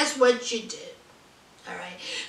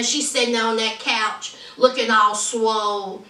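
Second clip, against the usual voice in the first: ordinary delivery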